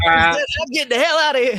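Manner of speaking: high, loud comic exclamation; skit newscaster bailing out. Retold or imitated. Retelling the Scary Movie skit to friends, hammed-up alarm. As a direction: cry it out high, hammed-up for laughs. Same skit quote, then laughs.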